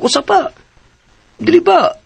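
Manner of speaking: sharp disbelieving question; catching her out, voice shooting up in doubt; incredulous challenge. Acted. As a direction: pitch leaps up high on dili ba. Friend presses a suspicious point.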